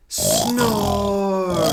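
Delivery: very high and loud; booming. A narrator's reading. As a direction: bellow the snore word. Dramatic snore read.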